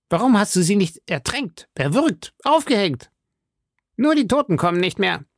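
high, agitated musketeer voice; exclaiming. A narrator's reading. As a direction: demand it in high outbursts. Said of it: "rief Porthos". (hoch und aufgebracht ausgerufen)

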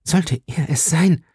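slow, halting question in mild shock; stunned, hesitant disbelief; stammering. A narrator's reading. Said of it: "stammelte sie".

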